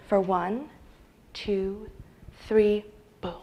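slow, fading count with pauses; hushed countdown. Acted. Storyteller counts out the brief chill before blackout, hushed countdown. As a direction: savour each second softly, slowly.

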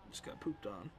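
soft unvoiced aside after a death; a defeated whispered aside. Real, not acted. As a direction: breathe it out to yourself. Muttering after dying.

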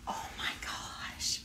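breathless whispered gasp; awed whisper. Real, not acted. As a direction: whisper it in awe. Thrilled by Sarah J Maas tapestry.

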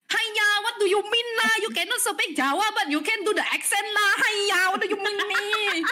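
high, sing-song "haiya, lah" Chinese-English; mock Chinese accent. Friend teasing the speaker in a mock Chinese accent. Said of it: "Chugoku no akusento desu ne".

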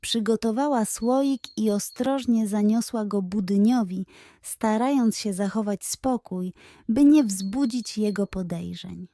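usual speaking voice

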